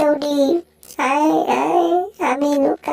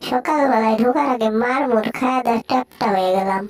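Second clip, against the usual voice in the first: normal talking voice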